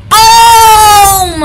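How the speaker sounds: high, loud shout of "Boom!"; explosive shout. Real, not acted. Gamer watching an explosion, shouting loudly. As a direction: yell it as the blast goes off.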